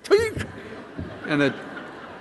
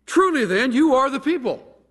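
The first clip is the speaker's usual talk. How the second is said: high, loud mocking sarcasm; sarcastic Job; 11 semitones higher, 4 dB louder than usual. Job mocking his three friends, high sarcastic jab. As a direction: sneer it back at his friends. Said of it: "having learned a little sarcasm from his friends".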